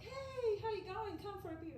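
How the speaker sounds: high, bright-pitched friendly greeting; friendly Aussie neighbor. Retold or imitated. Aussie campers invite the new neighbor for a beer, high cheery call. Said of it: "Aussies are super friendly people, and it goes like".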